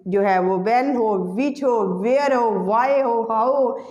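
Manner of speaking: the wh-words drawn out slowly one after another; slow listing. Shown as a demonstration. Teacher listing the wh-words for students, slowly. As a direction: spell out the list slowly for the class.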